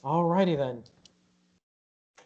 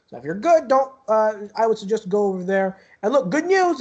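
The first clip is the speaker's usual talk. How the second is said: higher-voiced guard, chatty reassurance; helpful guard rambling on, pitched up; reassuring security guard; 5 semitones higher, 5 dB louder than usual. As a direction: reassure eagerly in a raised voice. Game master plays guard reassuring player.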